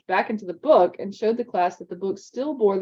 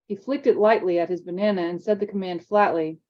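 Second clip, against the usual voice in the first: ordinary delivery, nothing marked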